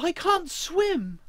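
high, drawn-out wail of panic; crying out. A narrator's reading. Emma flounders in the river, crying out. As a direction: wail it slowly, high-pitched. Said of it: "Emma cried".

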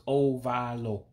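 ovalo said oh-VAH-loh; three clear syllables. Shown as a demonstration. Teaching learners the word for oval, three clear syllables. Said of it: "So there's three syllables".

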